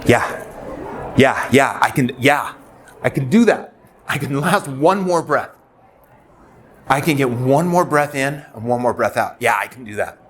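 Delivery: retold desperate relief, high and insistent; desperate agreement. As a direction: grasp at it desperately, pitched well up. Retold desperate promise while crying.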